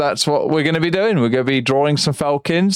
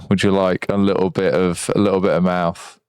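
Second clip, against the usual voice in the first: deep voice; 7 semitones lower than usual. Shown as a demonstration. Playing with deep voice changer.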